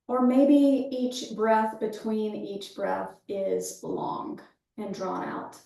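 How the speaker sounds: calm breath-awareness cueing, easing down at phrase ends; guided meditation. Real, not acted. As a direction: guide them gently into the breath, leaving space after each phrase.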